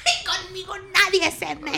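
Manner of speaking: high, menacing villain threat. Retold or imitated. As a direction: play a tough telenovela villain. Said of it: "Y termina así de".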